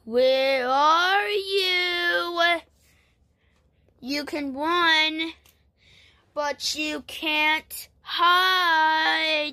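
very slow, drawn-out monster taunt; menacing sing-out, play-acted; creepy monster. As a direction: stretch each word like a stalking monster. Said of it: "That sound like a creepy monster".

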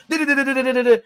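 high-pitched mocking babble; mimicked chef rant. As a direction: parody his telling-off in rapid syllables. Mimics the chef's ranting as nonsense.

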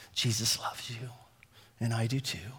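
a hint of a shy little girl; lightly voiced shy girl. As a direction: say it gently like a timid child.

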